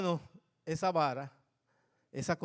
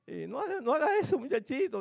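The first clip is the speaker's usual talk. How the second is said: mock scolding; 7 semitones higher than usual. Regaño al nieto.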